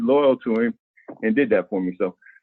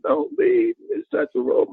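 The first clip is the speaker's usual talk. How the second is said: much higher imitating an old lady begging; old lady pleading; slower than usual. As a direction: mimic a tearful old lady, voice pitched way up. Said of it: "little old lady came up to me crying".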